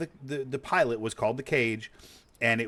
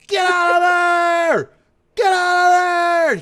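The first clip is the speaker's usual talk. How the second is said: drawn-out, high, full-force shout; hammed-up panicked movie yell; Krug yelling; 13 dB louder, much slower than usual. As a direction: scream the evacuation order, stretching it out. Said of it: "and then Krug yells".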